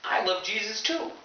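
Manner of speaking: high-pitched eager delight; hammed-up wide-eyed enthusiasm; joining the excitement. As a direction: gush along in a high voice. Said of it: "And I'm like".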